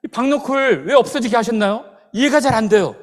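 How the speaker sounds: loud, high, aggrieved complaint; sullen grumbling. Retold or imitated. Prophet complaining to God about the withered vine, loud and aggrieved. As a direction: whine loudly at God, imitating the prophet. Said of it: "볼멘 소리 하잖아요".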